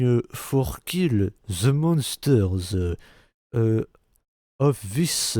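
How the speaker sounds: put-on slow, halting English in a thick French accent, hesitating on every word; lightly hammed-up French-accented English; mock French accent. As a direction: play a clumsy French hero fumbling his English lines in a French accent. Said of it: "ils avaient un accent français, euh, genre".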